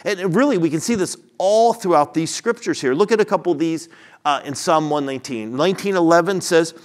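emphatic preacher driving each phrase home; impassioned preaching. Real, not acted. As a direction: proclaim it forcefully to the congregation. Preacher continues at full force.

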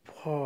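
फा with the आ held long, no r. Shown as a demonstration. Teacher drills far to students as a long फा. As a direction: stretch the आ in फा. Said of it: "आ पे को थोड़ा सा ज़्यादा time देते हुए".

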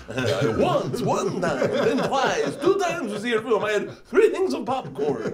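high Eastern European vampire, slightly out of breath; breathless vampire accent. Acted. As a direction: play a winded Transylvanian mobster, half laughing. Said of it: "your vampire is slightly out of breath".

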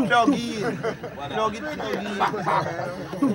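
high laughing mock call; giggling while playing it up. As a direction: laugh through the words. Imitating gun sound, laughing.

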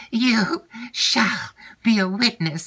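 high hissed whisper of an indignant wife; Madame Montoni's whisper. Acted. Aunt defying her husband before Emily, high whisper. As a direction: whisper it high, bristling with defiance.

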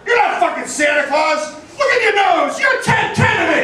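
mock angry heckle, pitched high; drunk fan yelling. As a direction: yell it across the parking lot. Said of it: "yells out".

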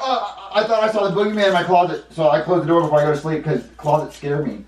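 high, loud excuse-making voice; flustered kid character. Acted. Junior covers for noise in the closet, flustered. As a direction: babble a scared kid's excuse.

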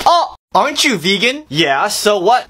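ordinary talk, nothing marked

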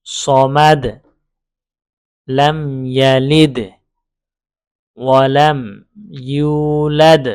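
loud, very slow recitation with long held vowels; broken-up recitation. Shown as a demonstration. Teacher breaking up the verses for learners, loud drawn-out recitation. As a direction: recite piece by piece, pausing after each. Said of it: "ভেঙে ভেঙে পড়লাম".

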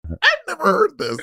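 high squeal. Retold or imitated. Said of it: "I was like".

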